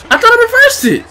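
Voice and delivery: squealed disbelief; high-pitched protest. Real, not acted. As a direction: complain in a high, upset voice. Game move fails.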